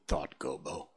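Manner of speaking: ordinary delivery, nothing marked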